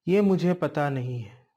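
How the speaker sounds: usual speaking voice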